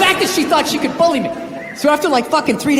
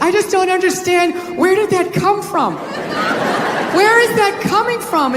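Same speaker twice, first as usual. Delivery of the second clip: higher, slow, mock bewildered wife; wife imitation; 6 semitones higher than usual. Wife asking where his temper comes from, mock bewildered. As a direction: play it slow and puzzled, higher. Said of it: "She's always just like".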